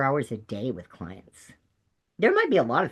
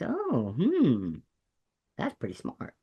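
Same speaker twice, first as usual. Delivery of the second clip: put-on Texas accent; slower than usual. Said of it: "I can do the accent pretty good".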